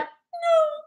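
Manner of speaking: very high, faint, crying "no"; a tiny broken cry for help; weak cry. Retold or imitated. Finally answers the stranger.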